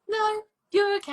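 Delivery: high-pitched mock refusal; Game of Thrones. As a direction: voice the show breezily turning it down, high. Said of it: "Game of Thrones comes in and goes".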